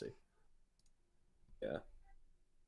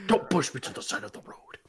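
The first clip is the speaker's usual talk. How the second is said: mock anime character whisper; breathy hammed-up anime line; overacted anime dub; 7 semitones higher than usual. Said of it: "Sounds like an anime character".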